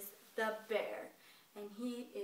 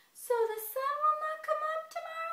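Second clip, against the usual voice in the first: very high, louder sing-song small voice; little squirrel; 6 dB louder than usual. Acted. Squirrel double-checking Bear's claim, high-pitched questioning. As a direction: question in a tiny piping voice. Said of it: "Little brown squirrel's like".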